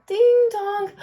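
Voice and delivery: high, loud ding dong; doorbell chime. Acted. Teacher reading the last house, a loud doorbell. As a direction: ring it out brightly.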